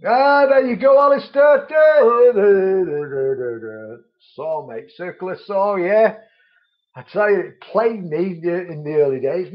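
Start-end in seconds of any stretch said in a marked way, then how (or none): 0.1-4.0 high excited shout trailing down into da-da-das; loud call-out fading. Real, not acted. Calls out to Alistair, then dah-dahs.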